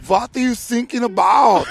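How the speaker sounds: high, loud and slow, laughing through it; mock German officer cracking up; German accent punchline. Coast Guard mishearing sinking as thinking, laughing mock German accent. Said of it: "And the German Coast Guard goes".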